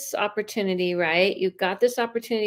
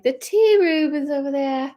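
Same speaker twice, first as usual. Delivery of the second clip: higher-pitched, hammed-up English accent; badly faked English accent, pitched up; terrible English accent; 5 semitones higher, 4 dB louder than usual. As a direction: put on a bad English accent. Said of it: "Why do I do an English accent?".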